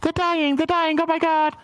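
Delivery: high, breathless mock panic; panicked inner voices. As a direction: gasp it like a haunted flashback. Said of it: "voices in her head".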